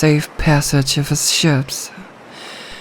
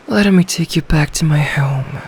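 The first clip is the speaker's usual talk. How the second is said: normal talking voice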